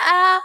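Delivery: high, loud "ow"; sudden high-pitched cry of mock pain; retold pained yelp. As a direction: yelp as if the foot rub hurts. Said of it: "I'm like".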